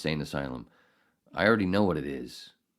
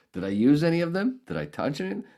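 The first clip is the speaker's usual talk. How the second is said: indignant questions; 9 semitones higher, 4 dB louder than usual. Real, not acted.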